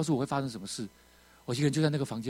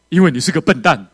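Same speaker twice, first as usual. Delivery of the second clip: loud pretend rebuke; much louder mock telling-off; mock scolding; 3 semitones higher, 15 dB louder than usual. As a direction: blurt the insult out hard as a joke. Mock quote of what God wouldn't say.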